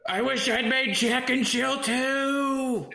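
put-on high whiny baby voice, slow; whiny Adam Sandler voice. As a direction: whine it out like a big baby. Voicing Sandler's dying last words.

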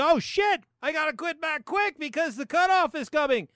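high-pitched, hammed-up alarm; mock panic. Host reenacting beating the cutoff, in mock panic. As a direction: blurt it like a deadline is looming. Mimics own rush before cutoff.

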